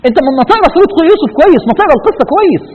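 high-pitched, insistent reproach; exasperated scolding. Real, not acted. Preacher urging listeners to read Joseph's story properly, exasperated. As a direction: scold them with voice pitched right up.

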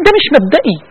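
ordinary talk, nothing marked

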